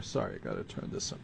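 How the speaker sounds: very soft, low, hurried apology; quick aside. Real, not acted. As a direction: mutter a hasty aside away from the listener. Aside while adjusting the equipment.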